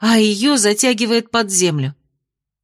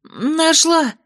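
higher, called out; calling out; 9 semitones higher than usual. A narrator's reading. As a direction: shout the answer across the clearing. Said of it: "крикнула в ответ Майя".

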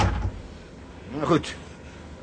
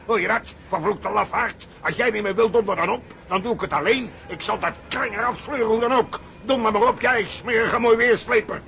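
raised, scornful tirade at a deserter; furious outburst; 6 semitones higher than usual. Acted. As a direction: spit the insults out in rage. Furious quarrel with partner. (woedend en hoog, scheldend)

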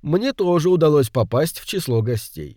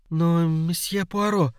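pleading; 5 semitones higher, slower than usual. A narrator's reading. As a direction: plead helplessly. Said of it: "взмолилась Джейн".

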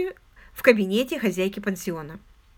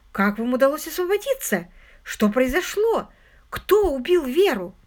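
higher, insistent and agitated; compulsive questioning; 5 semitones higher, 4 dB louder than usual. A narrator's reading. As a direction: press for answers, unable to stop. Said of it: "но не могла остановиться".

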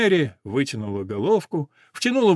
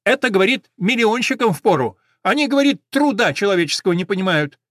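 high, loud tattling sparrow; indignant sparrow; 6 semitones higher, 5 dB louder than usual. A narrator's reading. Sparrow quotes the shopkeeper against costly horses, indignant. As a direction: pile on the reproach in a raised voice.

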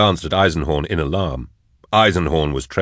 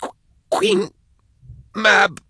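high stuttering answer; very high, halting, tormented voice; spluttered; slower than usual. A narrator's reading. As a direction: choke the name out in pieces. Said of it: "she spluttered".

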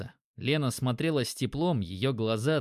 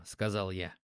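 plain reading voice

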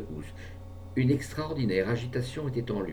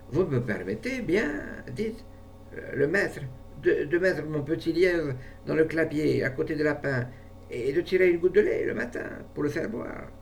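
put-on boy pleading; 6 semitones higher than usual. A narrator's reading. Farmhand asks boss.

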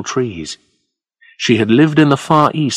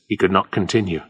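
usual speaking voice

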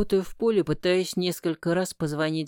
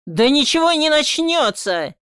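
much higher, louder furious yell; yelling back; 10 semitones higher, 8 dB louder than usual. A narrator's reading. Son yelling back at his father, furious. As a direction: shout him down. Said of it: "ору в ответ".

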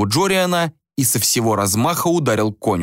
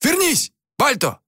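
high, sharp hail; acted holler after someone leaving; calling out. A narrator's reading. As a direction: call your son back. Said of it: "окликнул Рюйс сына".

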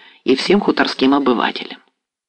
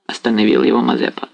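plain reading voice

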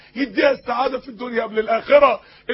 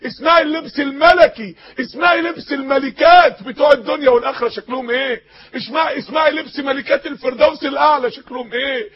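rousing exhortation; 2 semitones higher, 4 dB louder than usual. Acted. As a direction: preach it out to the crowd. Preacher rising to exhortation.

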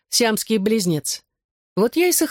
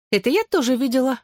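higher-pitched knowing taunt; snide; 7 semitones higher than usual. A narrator's reading. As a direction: rub it in, pitched up. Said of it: "язвительно добавила она". (язвительно, высоким голосом)

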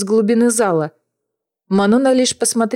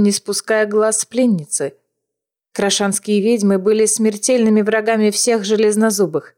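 ordinary delivery, nothing marked

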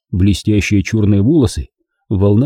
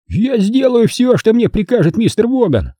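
snapping; 12 semitones higher than usual. Acted. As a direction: snap back defiantly. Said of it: "огрызнулся тот".

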